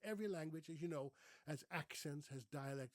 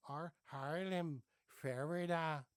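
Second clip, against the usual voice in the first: "Haarlem" and "Ferwerda" said slowly with a Haarlem-style Dutch R; a Dutchman imitating the Haarlem R; 5 dB louder, slower than usual. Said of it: "everybody spoke with this R".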